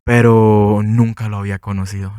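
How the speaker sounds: ordinary talk, nothing marked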